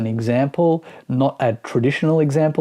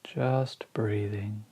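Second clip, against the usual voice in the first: slow, spacious meditation guidance with long pauses; guided meditation; slower than usual. Acted. Teacher guiding listeners through a body scan, slow meditation voice. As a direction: ease each phrase out slowly, letting it settle.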